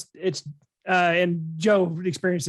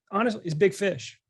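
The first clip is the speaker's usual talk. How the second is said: ordinary talk, nothing marked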